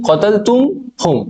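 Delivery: ordinary delivery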